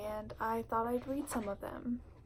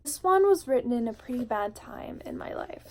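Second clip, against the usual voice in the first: ordinary delivery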